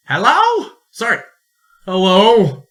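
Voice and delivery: loud, high hello into the distance; calling out. Acted. Explorer calls for the missing merchant, loud and high. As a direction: call out searching for a survivor.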